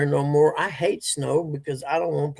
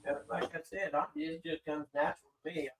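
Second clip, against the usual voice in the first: distant, off-mic and faint; talking from across the house; from another room; 2 semitones higher, 13 dB quieter than usual. Real, not acted. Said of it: "Can't hear you".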